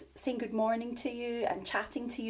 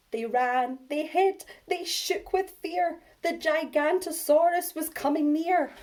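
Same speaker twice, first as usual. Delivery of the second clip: rising scared refrain; 7 semitones higher, 6 dB louder than usual. A narrator's reading.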